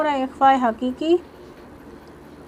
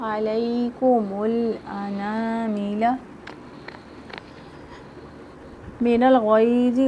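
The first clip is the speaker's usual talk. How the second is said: slow recitation demo; 4 dB quieter, much slower than usual. Shown as a demonstration. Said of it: "मीम को अगलाम के साथ मिला के पढ़ो".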